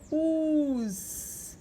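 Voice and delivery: slow "us" with a long final hiss; teacher sounding out "us"; syllable demo. Shown as a demonstration. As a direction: draw out "us" as in ônibus. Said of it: "Vou botar o S depois".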